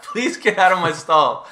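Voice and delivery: loud, high comic yell; mock desperate plea. Man ordering intruder out of his bathroom stall, loud mock plea.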